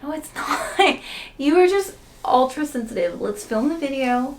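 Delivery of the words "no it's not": laughing. Real, not acted. Laughing while teased.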